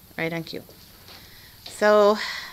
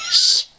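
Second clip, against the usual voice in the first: shush; 8 dB louder than usual. Real, not acted. Hushing talk about Brie.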